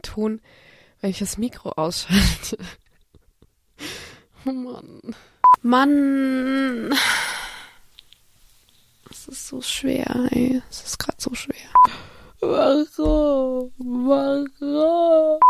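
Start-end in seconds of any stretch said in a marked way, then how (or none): 4.5-15.4 whiny half-crying; mock despair. Real, not acted. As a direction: whimper in mock frustration. Frustrated at recording troubles.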